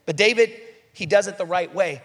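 ordinary delivery

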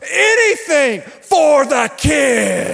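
high, slow, loud triumphant declaration; proud fan. Retold or imitated. Saints fan to the Cowboys fan, high proud shout. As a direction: lay it down like a victory taunt. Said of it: "he goes".

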